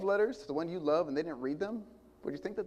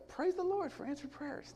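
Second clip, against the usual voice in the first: high, slow, sweetly innocent voice; innocent praise; 12 semitones higher, 4 dB quieter, slower than usual. Retold or imitated. Preacher imitating himself hearing the news, mock innocent praise. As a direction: play the innocent believer, all piety. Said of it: "And I'm just like".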